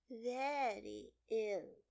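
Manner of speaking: high, slow, whispery dormouse voice; half-asleep little creature; sleepy Dormouse. A narrator's reading. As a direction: tell it drowsily in a feeble high voice.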